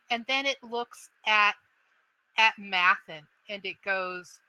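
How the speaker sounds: ordinary delivery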